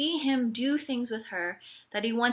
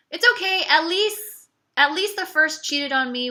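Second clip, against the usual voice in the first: forced cheer; 6 semitones higher, 7 dB louder than usual. Real, not acted.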